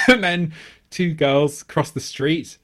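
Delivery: ordinary talk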